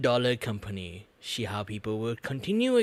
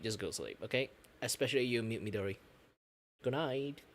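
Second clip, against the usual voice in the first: quiet; 6 dB quieter, faster than usual. Real, not acted. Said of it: "I should be a little bit silent".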